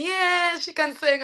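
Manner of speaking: imitated cheering crowd. Everybody clapping for her high notes, imitated cheer. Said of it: "everybody clapping and saying".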